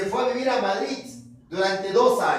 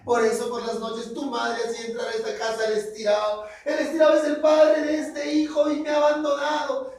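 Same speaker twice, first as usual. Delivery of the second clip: crying Lola; 5 semitones higher than usual. Acted. Lola cries and blames Pascual for leaving. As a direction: sob out the blame at him. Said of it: "Lola empezó a llorar".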